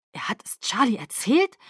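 tonlos hervorgestoßen; flüstere die Frage vor Schreck; fassungsloses Flüstern. Acted. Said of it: "stieß ich hervor". (Bella erfährt von Charlie, tonlos geflüstert)

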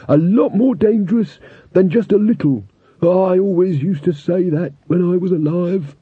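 a chatty skull pronouncing wisdom; skeleton voice. A narrator's reading. Talking skull character.

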